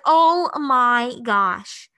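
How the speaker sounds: slow, stretched-out groan of embarrassment; drawn-out dread. Retold or imitated. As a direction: drag each word out in mock despair. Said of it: "And I was like".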